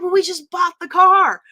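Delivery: loud, high indignant retort; indignant outburst. Retold or imitated. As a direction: shout it back, offended. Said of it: "And I'm like".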